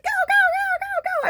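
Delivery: very high, loud cheering; shrieking cheerleader encouragement. Shown as a demonstration. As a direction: yell it like you're pumping up the team from the sidelines. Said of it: "I'm like".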